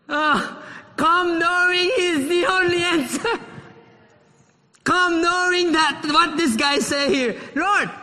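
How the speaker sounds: high, loud, ringing sermon voice; fervent preaching. Real, not acted. Pastor urging the congregation to come to Jesus, fervent. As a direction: preach it out with joy.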